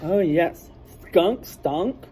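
ordinary delivery, nothing marked

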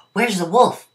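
hushed but urgent call; an anxious villager calling out; cried out. A narrator's reading. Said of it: "a villager cried out".

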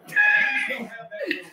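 shrieking laugh at the fallen bell; high shrieking laugh. Real, not acted. Laugh after bell falls.

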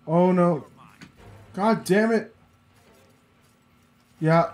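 normal talking voice

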